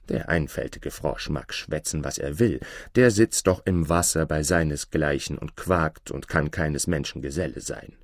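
low aside of a dismissive princess; princess's low, disdainful inner voice; scornful private thought. Acted. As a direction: think it to yourself, pitched low. Princess thinks scornfully to herself.

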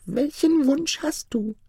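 very high, slow chirping spirit voice; eerie high chirp of a ring spirit. A narrator's reading. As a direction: chirp it out like a tiny beetle spirit. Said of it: "zirpte die Stimme".

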